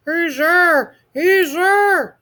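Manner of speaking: high, loud little monster shouting; a scared monster running to his mother; panicked shout. A narrator's reading. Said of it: "he shouted".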